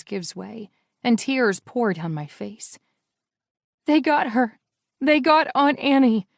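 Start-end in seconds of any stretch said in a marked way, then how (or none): 3.8-6.3 crying. A narrator's reading. Heroine crying to Andrei.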